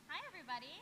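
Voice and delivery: nearly lost, mic not on. Real, not acted. Guest greeting the crowd on a dead microphone, barely heard. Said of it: "Try again".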